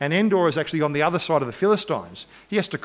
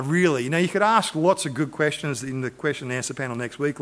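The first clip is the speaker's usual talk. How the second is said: ordinary delivery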